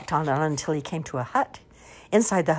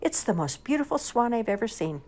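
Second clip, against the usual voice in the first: put-on high, wondering child's voice; high-pitched awe; admiring child; 7 semitones higher than usual. A narrator's reading.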